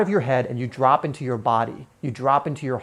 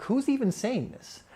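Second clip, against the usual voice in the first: curious wondering; 7 semitones higher, 7 dB quieter than usual. Retold or imitated. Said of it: "You're just going like".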